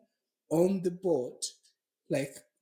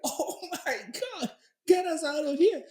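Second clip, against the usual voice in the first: high, loud plea through laughter; hammed-up cry to be rescued; retold group panic; 9 semitones higher, 4 dB louder than usual.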